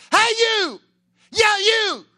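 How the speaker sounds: high, loud taunting call; mock taunting shout. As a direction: yell it up the cliff at the enemy. Calling out to enemy soldiers.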